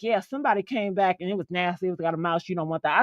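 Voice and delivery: low, softened imitation of the cleaner; low confiding. Cleaner warning customer off a car with a mouse, low confiding. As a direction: drop down and confide it matter-of-factly.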